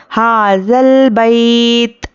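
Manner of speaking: slow chanted tilawat; Quran recitation. Shown as a demonstration. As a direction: recite slowly with long vowels. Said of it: "teacher recites". (धीमी तिलावत)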